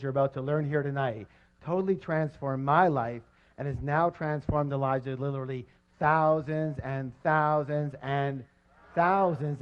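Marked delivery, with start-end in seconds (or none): 6.0-9.6 slow, stretched thousands; drawn out. Real, not acted. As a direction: linger on every \"thousands\". Speaker drags out emphasis.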